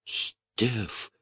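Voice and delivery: soft, drawn-out whispered name. A narrator's reading. Lucy pales at the revolver order, whispering. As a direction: whisper it, aghast. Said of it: "прошептала Люси бледнее".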